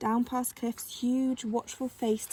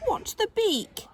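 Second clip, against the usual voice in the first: much higher, slow squawking bird; parrot voice; 11 semitones higher, 4 dB louder, slower than usual. Acted. Parrot voice complaining.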